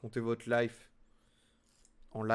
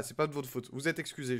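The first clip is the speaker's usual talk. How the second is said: ordinary talk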